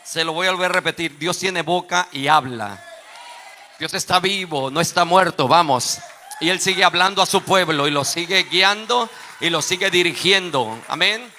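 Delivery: rally the congregation with a shout; fervent preacher projecting hard; full-force preaching. Real, not acted.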